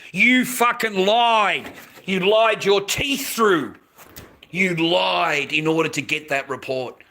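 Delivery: real anger, slow hard stress on the key words; heated public accusation; angry accusation. Real, not acted. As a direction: hurl the accusation, drawing out each charge. Accusing someone of lying.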